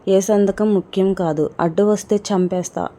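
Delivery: ordinary delivery, nothing marked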